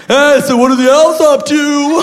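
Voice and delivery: high-pitched gushing fan; creepy fan with a high, manic laugh; overexcited creepy fan. Acted. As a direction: blurt it out high like a starstruck creep. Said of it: "Be a creep, like".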